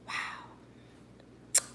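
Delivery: hushed, breathy whisper of pleasure; whispered delight. Retold or imitated. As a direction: whisper it like a fine wine. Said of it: "you're just like".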